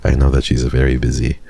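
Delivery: ordinary talk, nothing marked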